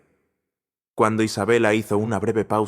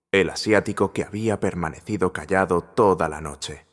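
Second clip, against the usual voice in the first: plain reading voice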